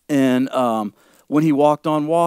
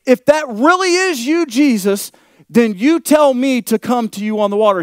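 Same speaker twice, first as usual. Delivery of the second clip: Peter pleading; 6 semitones higher, 4 dB louder than usual. Acted. Preacher voices Peter again.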